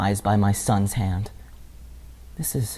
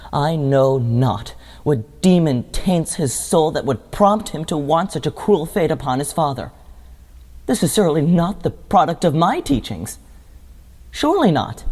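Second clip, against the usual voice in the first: anguished disbelief; 4 semitones higher than usual. Acted. Father denying he taught his son treachery, anguished disbelief. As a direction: protest it, climbing to a high final denial.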